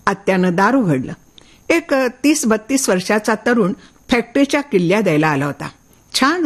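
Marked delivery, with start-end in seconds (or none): none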